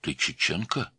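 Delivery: mildly surprised, rising question. A narrator's reading. Man on phone learning caller's origin, surprised. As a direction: ask with understated surprise. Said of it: "удивился говоривший".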